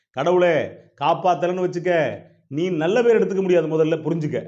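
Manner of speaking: high, loud, insistent threat; forceful warning. Acted. Satan warns God about his good name, forceful. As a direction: drive the warning home loudly.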